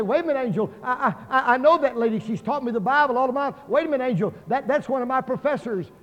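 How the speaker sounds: high, stammering objection; acted protest. Churchgoer stopping the angel from taking someone they know, high urgent protest.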